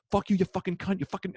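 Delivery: high-pitched angry rant; retold losing it. Comic losing it on the bar crowd, high-pitched rant. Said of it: "I lost it on all of these people".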